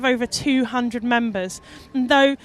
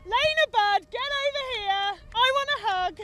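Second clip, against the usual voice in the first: calling out; 10 semitones higher, 8 dB quieter than usual. Real, not acted. Calling Lena over for hug.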